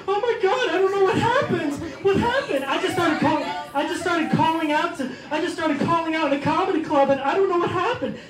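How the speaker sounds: high, put-on mock innocent woman. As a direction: whine it like a fake-innocent woman. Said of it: "and then they give this look".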